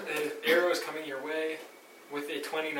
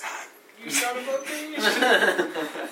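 slow, high, laughing exclamation; laughing curse; 8 semitones higher, 4 dB louder, much slower than usual. Real, not acted. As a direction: groan it out while laughing. Frustrated outburst at a bad roll.

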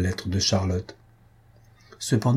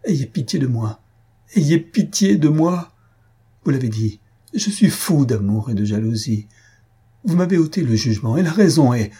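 desperate begging, swelling higher and louder; heartrending plea; 4 semitones higher, 4 dB louder than usual. A narrator's reading. Jealous lover begging the countess for pity, heartrending. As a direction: plead with stretched, anguished emphasis. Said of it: "avec un accent déchirant".

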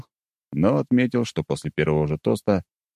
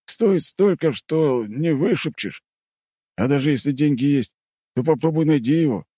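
higher, marvelling rough guardsman; awed soldier; 9 semitones higher than usual. Acted. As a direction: gush over the bottle like a soldier.

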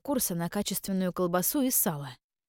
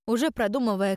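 ordinary delivery

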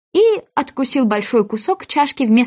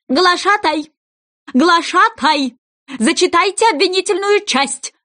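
royal command; 8 semitones higher than usual. Acted. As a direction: call the herald like a fussy king. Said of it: "распорядился король".

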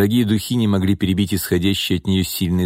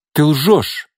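higher, louder, other voice; accusing cry; 7 semitones higher, 4 dB louder than usual. A narrator's reading. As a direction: throw the accusation at him. Nina accuses Gordey.

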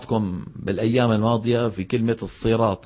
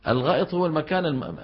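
ordinary delivery

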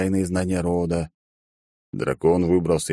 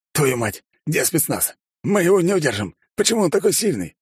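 panicked shout; 10 semitones higher than usual. A narrator's reading. Struggling officer panics.

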